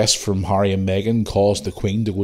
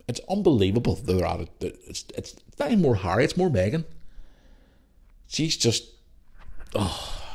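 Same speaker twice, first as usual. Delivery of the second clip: normal talking voice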